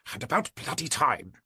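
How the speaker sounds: muttered complaint in clipped British English; impatient, clipped British character voice; clipped British accent. A narrator's reading. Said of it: "he muttered, in a distinctly clipped British accent".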